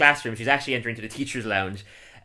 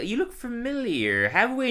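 high-pitched imitation of a teacher; slow, high mock-teacher voice; imitated Peyton; 11 semitones higher than usual. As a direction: lift it high and draw it out. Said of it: "and Peyton's like".

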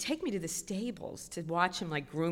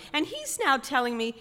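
plain reading voice

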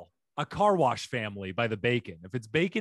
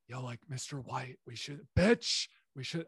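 soft impression slipping into another character; Jesse; 6 dB quieter than usual. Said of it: "such a good Jesse impression".

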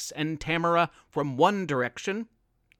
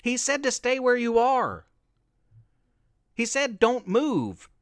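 yelled; 5 semitones higher than usual. A narrator's reading. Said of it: "yelled after her newfound friend".

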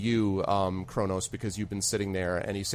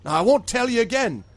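put-on higher, louder stern old woman; stern scolding; 10 semitones higher, 5 dB louder than usual. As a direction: warn them off hard. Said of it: "she says".

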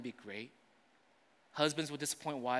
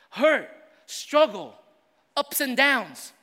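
high, loud, driving preacher voice; loud sermon delivery building through the list; full-force preaching; 11 semitones higher, 9 dB louder than usual. Acted. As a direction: preach it out to the whole congregation. Preaching to the church.

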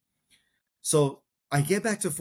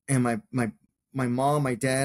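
usual speaking voice

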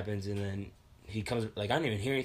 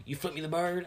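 higher-pitched imitated accusation; incredulous mock accusation; retold challenge; 7 semitones higher than usual. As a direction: mimic an affronted stranger confronting you. Acting angry stranger's challenge.

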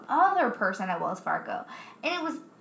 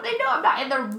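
normal talking voice

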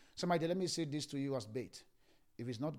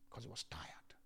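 whisper; 13 dB quieter than usual. Acted. Preacher hushes about Benjamin.

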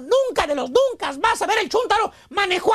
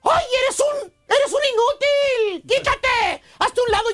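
higher-pitched, drawn-out exasperated scolding; mock furious wife; 4 semitones higher, slower than usual. Angry wife pushing useless husband aside, acted exasperation. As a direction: act out a fed-up wife snapping at her husband. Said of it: "Y encabritada la señora le contesta".

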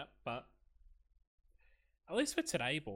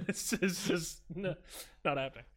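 laughing high and loud; 8 semitones higher, 6 dB louder than usual. Real, not acted. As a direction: laugh through it. Laughing denial.

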